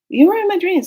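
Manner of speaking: high, loud delighted exclamation; excited greeting. Retold or imitated. Telling the cat at the door, excited high cry. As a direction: burst out at the cat with glee.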